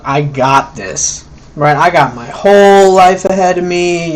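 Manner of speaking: ordinary talk, nothing marked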